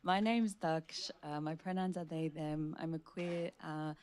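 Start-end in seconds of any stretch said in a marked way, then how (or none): none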